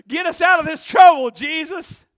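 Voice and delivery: high, loud desperate cry; shouted plea. Retold or imitated. The thief begging Jesus from the cross, shouting.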